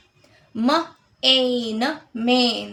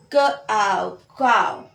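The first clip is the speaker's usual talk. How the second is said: ordinary talk, nothing marked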